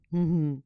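light giggle; fond little laugh. Acted. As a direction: laugh tenderly at the little flower. Tree laughs fondly.

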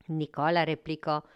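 usual speaking voice

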